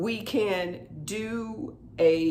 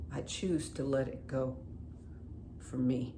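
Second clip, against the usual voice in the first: calm releasing; 8 dB quieter than usual. Acted. Tapping on letting the anger go, softly and calmly. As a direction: wind down into calm acceptance.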